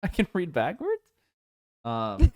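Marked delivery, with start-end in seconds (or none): 0.0-1.0 high, loud, laughing through words. Retold or imitated. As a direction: giggle through the quote. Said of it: "I'm like".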